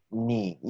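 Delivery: "ni" low and dipping, in third tone; third-tone demonstration. Shown as a demonstration. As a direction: let "ni" sink low, then lift. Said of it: "aparece en tercer tono". ("ni" en tercer tono, bajo y ondulado)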